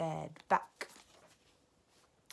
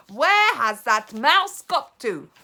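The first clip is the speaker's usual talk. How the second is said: loud, slow sharp demand; impatient witch snap; snapping; 6 semitones higher, 15 dB louder, slower than usual. Acted. Grand High Witch snapping at the audience, loud.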